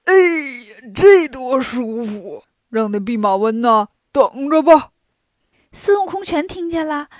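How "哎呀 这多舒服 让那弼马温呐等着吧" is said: loud, self-satisfied pig character, stretching out the comfort; smug. A narrator's reading. Pigsie lying in the grass while Wukong waits, smug. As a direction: gloat as you settle in. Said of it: "得意地说".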